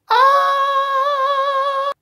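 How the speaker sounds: very high, long sustained scream; shriek. A narrator's reading. Lady in the crowd alarmed, shrieking. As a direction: let out a piercing acted shriek. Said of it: "they heard a shriek from the crowd".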